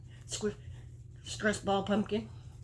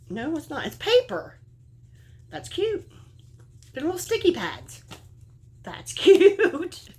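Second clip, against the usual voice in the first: delighted squeal; 11 semitones higher, 7 dB louder, slower than usual. Real, not acted. Delighted by the sticky pads.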